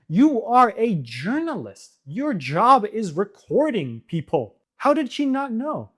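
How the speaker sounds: exasperated, pointed disbelief; incredulous rant. Real, not acted. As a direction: hammer home how absurd it is.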